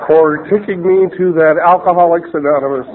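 usual speaking voice

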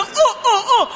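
high-pitched mock stammer; mimicking someone still blocked mid-stutter; imitated lock stutter. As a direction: get stuck on short, high "uh"s. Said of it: "he would stutter and kinda like a lock stutter".

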